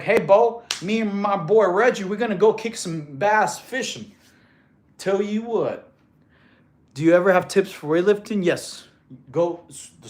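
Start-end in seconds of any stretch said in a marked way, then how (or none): 0.0-5.9 put-on good-old-boy Southern accent. Shown as a demonstration. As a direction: talk like a Southern buddy planning a fishing trip. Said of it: "Versus Southern, like".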